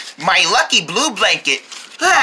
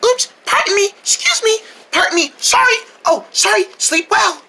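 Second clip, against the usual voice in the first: mock polite tiptoe; 11 semitones higher than usual. Sneaking past sleepers apologising.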